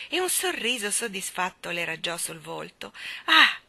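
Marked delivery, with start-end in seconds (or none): 3.3-3.6 high, loud cooing "ah"; crooning moan. A narrator's reading. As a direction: coo in adoration. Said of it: "mugolò".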